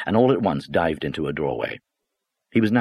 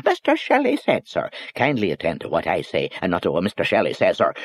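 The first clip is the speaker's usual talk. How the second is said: mocking boss. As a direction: throw his own words back at him. Boss mocking clerk's excuse.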